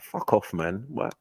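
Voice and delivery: ordinary talk, nothing marked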